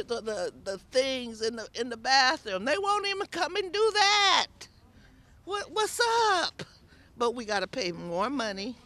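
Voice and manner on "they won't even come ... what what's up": high, exasperated complaint; indignant disbelief. Real, not acted. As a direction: throw up your hands in disbelief.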